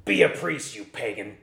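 lightly gravelly stern priest; a hint of a gruff priest's command. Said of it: "said with a gravelly voice".

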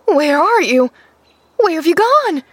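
high, loud panicked calling; frantic searching cry, rising in pitch; frightened cry. A narrator's reading. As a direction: call out in mounting alarm. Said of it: "she gave a frightened cry".

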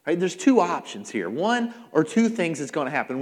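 ordinary delivery

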